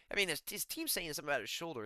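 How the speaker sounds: ordinary delivery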